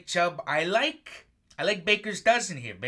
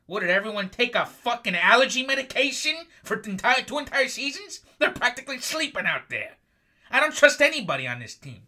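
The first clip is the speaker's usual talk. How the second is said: louder, higher mocking rant; angry rant; 7 semitones higher, 6 dB louder than usual. Real, not acted. Host ranting at the sleepy Falcons, loud and mocking. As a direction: tear into them, higher and louder.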